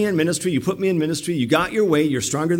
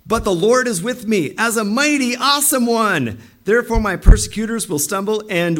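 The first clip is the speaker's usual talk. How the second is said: high, ringing declaration; triumphant proclamation; 6 semitones higher, 4 dB louder than usual. Acted. Jeremiah declaring the Lord is with him, triumphant. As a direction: proclaim it high and loud, with confidence.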